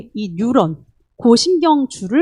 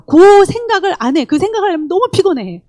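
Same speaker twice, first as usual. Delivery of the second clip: ordinary delivery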